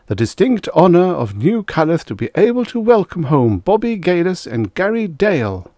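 high, loud ceremonial oratory; public address. A narrator's reading. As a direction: announce it grandly to a crowd. Mayor announces returning heroes to crowd.

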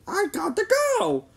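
high-pitched plea to mom; pleading kid. A narrator's reading. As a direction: beg to be allowed out. Boy protesting to his mother.

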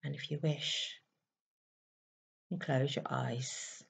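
soft, slow meditation voice with long pauses; guided meditation. Acted. Guiding listeners to close their eyes and breathe, soft meditation voice. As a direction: ease them gently into stillness, leaving space to breathe.

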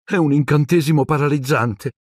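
high-voiced dismayed girl; disconsolate. A narrator's reading. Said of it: "in tono sconsolato". (tono sconsolato, voce acuta)

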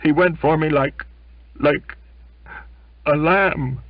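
high, loud, broken by tears; tearful outburst. Acted. Belfast tearfully insists to the narrator, high and loud. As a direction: blurt it out through tears.

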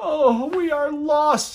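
loud, high, slow lament; despairing wail. A narrator's reading. The townspeople despair at the pasta, high slow wail. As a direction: wail that all is lost. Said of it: "said the people".

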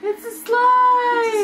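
high, drawn-out squeal of delight; excited squeal. Real, not acted. Player squealing as her character slides downstairs, thrilled. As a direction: whoop it out, slow and thrilled. Gleeful shout sliding down stairs.

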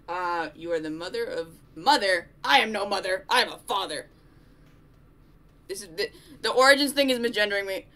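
loud indignant protest; outraged, loud complaint; raging gamer. Real, not acted. Outraged at being called mother.

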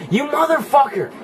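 high, enraged shout; real gamer rage; furious yell. Real, not acted. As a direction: curse the enemy at a high pitch. Hit again in game.

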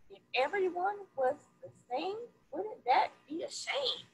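lively picture-book read-aloud; reading to children. A narrator's reading. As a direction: read it to a classroom of kids, bright and sing-song.

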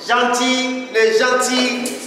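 high, drawn-out introduction of a performer; stage announcement. Real, not acted. Host presents the poet to the audience, high drawn-out announcement. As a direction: announce the act, stretching the name.

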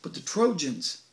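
ordinary delivery

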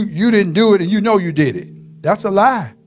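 usual speaking voice

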